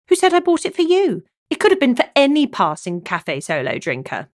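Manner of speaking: playful teasing. Acted. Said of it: "Angelica played".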